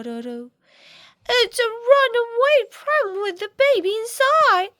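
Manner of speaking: ordinary delivery, nothing marked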